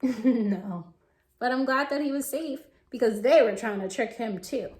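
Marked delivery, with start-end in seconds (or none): none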